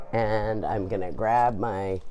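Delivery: ordinary talk, nothing marked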